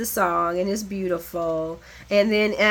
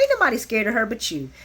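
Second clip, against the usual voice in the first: ordinary talk, nothing marked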